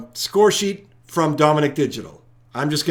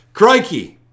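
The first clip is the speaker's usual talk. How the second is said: higher, louder Aussie exclamation; Australian accent; 6 semitones higher, 8 dB louder than usual. Shown as a demonstration. Host retrying for a disapproving viewer, emphatic Australian accent. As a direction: punch out a second try at an Australian accent. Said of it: "I don't approve the accent".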